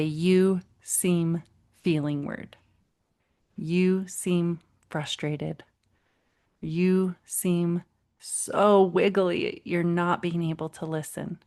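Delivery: very slow, soothing naming of feelings; unhurried, each word laid down gently for the child; slow calm voice. Shown as a demonstration. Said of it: "We're talking in a slow way, a slow pace, with a low voice".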